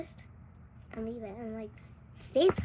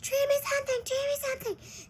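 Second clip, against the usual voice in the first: much higher, begging voice; pleading friend; 14 semitones higher, 5 dB louder than usual. Retold or imitated. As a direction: nag like a pushy kid. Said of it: "she was like".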